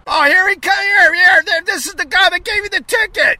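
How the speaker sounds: high loud alert; excited. Real, not acted. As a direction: point him out excitedly. Meter maid returns.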